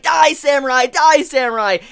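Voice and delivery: imitating an attacker's yell; mock battle cry. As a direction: hurl a threat, playing at fury. Acting out Ashi attacking Jack.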